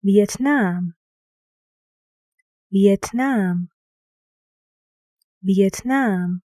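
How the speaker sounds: "Vietnam" with the beat on "nam"; stressed last syllable. Shown as a demonstration. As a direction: lean on a drawn-out "nam" in "Vietnam". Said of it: "guess which syllable is stressed".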